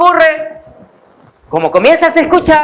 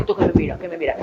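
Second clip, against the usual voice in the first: defensive mutter; 7 semitones lower, 8 dB quieter, faster than usual. Retold or imitated. Defensive husband to his wife.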